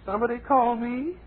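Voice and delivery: very high falsetto interruption; comic Mary character. Acted. Mary answers the host's bird call, high falsetto.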